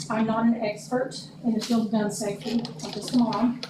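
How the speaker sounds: ordinary talk, nothing marked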